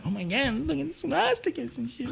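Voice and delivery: high, alarmed mock panic. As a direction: squeal it out like a scared newcomer. Freaked out by swastikas.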